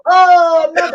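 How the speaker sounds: loud, high-pitched cry of comic horror; mock dismay. Players realising he understood their French, mock horrified outcry. Players' shocked reaction, acted out.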